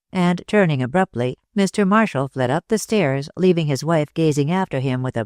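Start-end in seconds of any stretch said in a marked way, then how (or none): none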